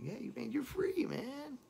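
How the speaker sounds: high, teasing voice of a friend; mock envious friend. Unhappy friend telling the singer he's free. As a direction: needle him about being free.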